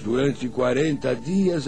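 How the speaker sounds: normal talking voice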